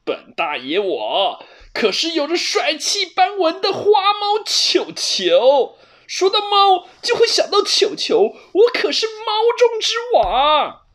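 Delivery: very high, loud swaggering cat with a laugh; smug boasting. A narrator's reading. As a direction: chest puffed out, crowing about himself. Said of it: "得意地挺起胸膛说". (得意洋洋的花猫，尖高大声地炫耀)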